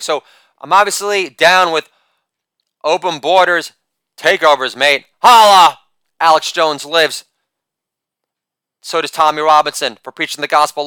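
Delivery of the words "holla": catchphrase shout. Acted. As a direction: bark it out to the crowd. Catchphrase shout after joke.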